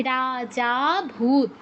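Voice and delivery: রাজা ভূত said very slowly, the জা held long; teacher stretching the pluta vowel for the class; drawn-out pluta demo. Shown as a demonstration. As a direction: stretch the জা of রাজা an extra beat. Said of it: "এমনভাবে উচ্চারণটা করতে হবে যেন".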